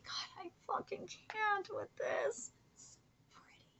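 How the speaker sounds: soft unvoiced whisper, slow; hushed reverent whisper; awed whisper. Real, not acted. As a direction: whisper it, overwhelmed by beauty. Whispered awe mid-reading.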